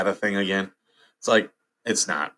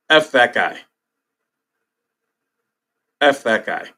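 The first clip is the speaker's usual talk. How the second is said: angry; 6 dB louder than usual. Real, not acted. Outraged at dog abuser.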